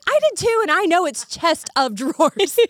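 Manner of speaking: high, hammed-up comeback; mock-outraged correction, slowing at the end; indignant retort. Acting out argument with assistant.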